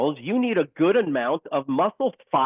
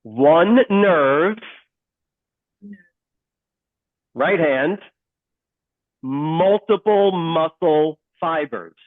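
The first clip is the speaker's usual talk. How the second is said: slow, louder, every word hit; emphatic call-and-repeat; 3 dB louder, much slower than usual. Shown as a demonstration. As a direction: spell it out for the class to echo. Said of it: "Say it, say it with me".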